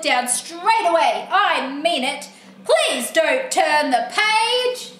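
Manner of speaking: outraged cry, then scolding threats; cross book character. Acted. Book character scolding the reader for turning on, cross. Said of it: "look how cross he is".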